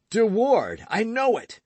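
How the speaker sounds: high, loud outburst; angry cry. Acted. Jealous lover blurting out the rival's name, loud. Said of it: "cried D'Artagnan".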